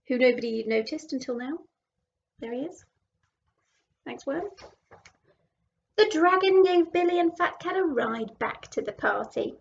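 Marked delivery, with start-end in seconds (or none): none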